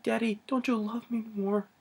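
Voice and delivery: high, soft imitation of a child; tiny high voice, imitated; little daughter's plea. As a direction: plead gently as a small daughter. Retelling dead daughter pleading.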